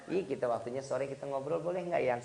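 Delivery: soft, low acted request; sweet-talking wife. Wife asking husband for an afternoon chat, soft and sweet. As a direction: ask it gently, as a coaxing wife.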